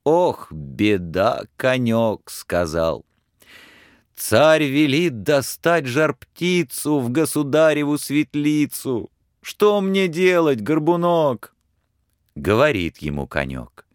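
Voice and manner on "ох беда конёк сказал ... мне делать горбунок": lightly voiced sorrowful lad; a lad at his wits' end; despairing Ivan. Ivan tells the horse the tsar's order, despairing.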